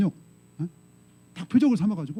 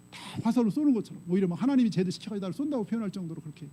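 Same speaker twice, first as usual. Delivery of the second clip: ordinary talk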